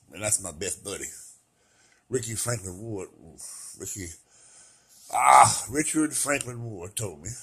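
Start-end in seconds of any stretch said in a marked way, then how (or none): none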